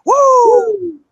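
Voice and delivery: high, loud wrestling-promo woo; Ric Flair woo. Pretend. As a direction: let out a big showman woo. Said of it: "I am Captain Flair".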